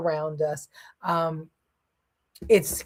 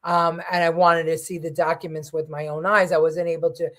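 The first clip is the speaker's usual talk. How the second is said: ordinary talk, nothing marked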